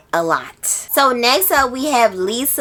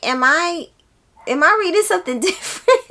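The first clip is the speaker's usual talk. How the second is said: puzzled laugh; 8 semitones higher, faster than usual. Retold or imitated. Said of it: "I kept feeling like".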